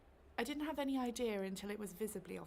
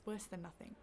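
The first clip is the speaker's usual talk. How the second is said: ordinary delivery, nothing marked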